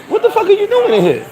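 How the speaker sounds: very high incredulous question; retold stunned demand, pitch way up; imitated shock. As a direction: squeal it in mock disbelief. Producer shocked to find teenager.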